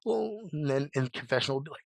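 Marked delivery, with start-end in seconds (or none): 0.1-0.5 high, held-back "oh" of fright; mock huge scream. Imitating Harold's reaction to the knife scare, restrained mock scream. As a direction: mimic a big scream without the volume. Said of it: "do, like, a huge scream".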